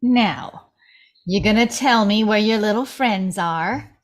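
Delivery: low, gloating threat; menacing Mama. Acted. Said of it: "Mama looks back at Chunk".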